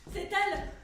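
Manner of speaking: loud, high exclamation; excited cry. Acted. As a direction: cry out on a sudden discovery. Découverte soudaine de la pierre. (exclamation forte, tout excitée)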